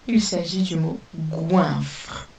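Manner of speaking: slow, careful dictation of goinfre; slow down so pupils can write goinfre; teacher dictating goinfre slowly. Shown as a demonstration. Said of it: "Je répète le mot goinfre".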